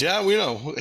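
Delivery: high, loud knowing reply; amused spirit guide. Retold or imitated. Spirit guide teasing him about the dream, high amused voice. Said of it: "And he goes".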